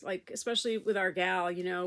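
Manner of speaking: ordinary talk, nothing marked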